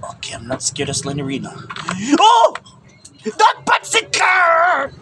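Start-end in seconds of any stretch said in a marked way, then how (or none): 2.2-4.9 startled scream. Real, not acted. Jump scare in horror game.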